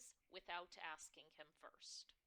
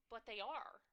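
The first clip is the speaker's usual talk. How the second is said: emphatic insistence; 4 semitones higher, 7 dB louder than usual. Acted. Insisting to herself about the dyes.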